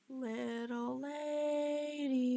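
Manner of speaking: very slow, drawn-out teasing coo; a menacing sing-song coo, stretched out. Acted. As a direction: coo it slowly like a predator toying with prey. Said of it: "you hear cooed".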